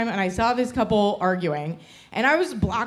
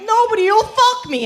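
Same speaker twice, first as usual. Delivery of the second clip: high, loud mock lament; mocked complaining men; 12 semitones higher, 5 dB louder than usual. Comic mimics the guys in the crowd, high mock lament. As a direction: wail it in mockery of lonely guys. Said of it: "You guys are like".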